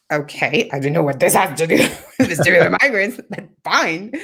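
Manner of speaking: dubious, sing-song going along with it; sceptical compliance. Retold or imitated. Patient puzzled by the therapist's task, sceptical. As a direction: humour the odd request with a laugh.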